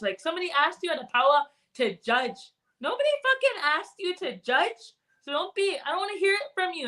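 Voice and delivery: a preachy auntie calling out the judgers, fast in spots; Auntie Beatrice. Acted. Joking as auntie, scolding people who judge others. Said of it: "I joke around as Auntie Beatrice".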